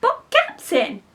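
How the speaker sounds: high, slow protest; a confused pirate objecting. Acted. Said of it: "the pirates yelled".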